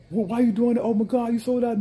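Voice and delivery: high, loud whining customer voice; mock an indignant customer, pitched high; whiny customer. Vendor imitates customers upset he stopped serving, high whine. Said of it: "customers protest".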